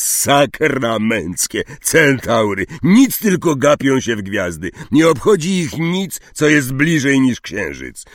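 loud, high exasperated rant; fed-up indignant grumbling; angry. A narrator's reading. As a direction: curse the stargazers in frustration. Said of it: "powiedział ze złością Hagrid".

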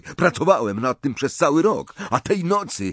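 put-on high, indignant young voice; heated self-defence; angry protest. A narrator's reading. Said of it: "zawołał ze złością Malfoy".

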